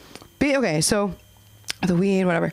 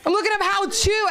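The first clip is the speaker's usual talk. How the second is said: mock teen meltdown, shrieking and laughing; hammed-up teen yell; 11 semitones higher, slower than usual. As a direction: yell at your mom like a cornered teenager. Acting out shouting at mom.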